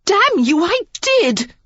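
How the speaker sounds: a loud, high, furious shout. A narrator's reading. Lily yells at the doubting earl, furious shout. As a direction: yell it at him in fury. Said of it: "she shouted".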